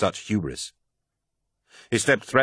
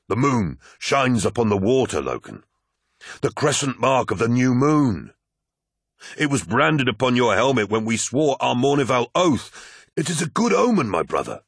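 higher-pitched, persuasive character voice; fervent Aximand; 5 semitones higher than usual. Acted. Aximand shows Loken the moon's reflection, fervent. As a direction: urge him fervently, pitch rising to the omen.

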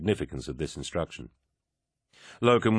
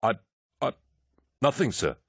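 alarmed stammer; 6 semitones higher than usual. A narrator's reading. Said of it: "causing him to start in alarm".

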